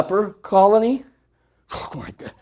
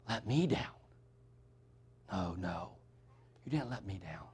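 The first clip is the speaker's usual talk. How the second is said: hushed sermon; 12 semitones lower, 10 dB quieter than usual. Acted. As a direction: pull the congregation in close and speak gently. Hushed story of the drunk and letters.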